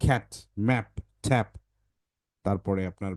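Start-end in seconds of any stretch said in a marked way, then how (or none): 0.0-1.6 cat and map spelled out slowly and clearly; slow example words. Shown as a demonstration. Teacher dictates simple words for parents, slowly. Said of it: "তাকে লিখতে হবে".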